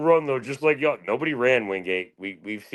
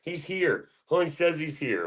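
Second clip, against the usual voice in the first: mocking quote; 7 semitones higher, slower than usual. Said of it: "We were like".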